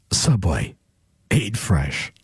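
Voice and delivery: very deep, subdued ad tagline; voiceover voice. Shown as a demonstration. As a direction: keep it low and light, with a pause. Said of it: "if I'm doing my voiceover voice".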